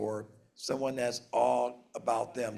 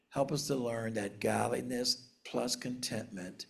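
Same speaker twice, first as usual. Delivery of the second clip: soft, low, unhurried praying; quiet prayer; 3 semitones lower, 5 dB quieter than usual. Acted. Pastor praying over the congregation, soft and reverent. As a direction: pray it softly to close the reading.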